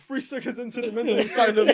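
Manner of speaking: high voice shaking with laughter; laughing. Real, not acted. Player topping the absurd scenario for friends, laughing. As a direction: deliver the joke while cracking up. Cracking up at own joke.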